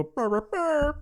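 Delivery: high-pitched Chewbacca-style roar; imitated Wookiee roar. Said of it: "He wanted to go".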